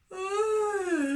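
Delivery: high loud yawning oh; sleepy yawn. Acted. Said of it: "as sleepily as he possibly could".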